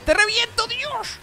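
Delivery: high squeal into a loud crow; gleeful taunting. Real, not acted. Gamer sends rival into the hole, high gleeful taunt. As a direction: crow loudly as the rival falls.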